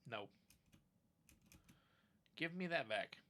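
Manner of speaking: usual speaking voice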